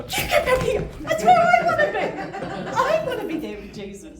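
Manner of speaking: high, loud laughing protest; laughing outburst. Retold or imitated. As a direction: shriek it out through laughter, half joking. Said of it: "And I said".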